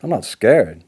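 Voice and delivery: sharp, loud and high; stung, defensive shout; angry denial. Acted. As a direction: snap the denial back at her. Snaps at the counsellor.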